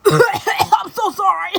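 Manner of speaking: high, slow, loud wailed sorry; mock tearful apology. Apologizing to him with tears brimming, a mock wail.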